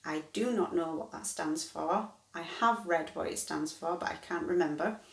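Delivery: ordinary talk, nothing marked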